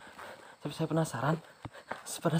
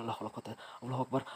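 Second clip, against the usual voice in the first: usual speaking voice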